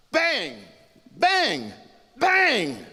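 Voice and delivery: loud, high exploding "bang" each time; shouted gunshots. A narrator's reading. Storyteller sounding the master's three shots, explosive shouts. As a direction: fire each word like a shot.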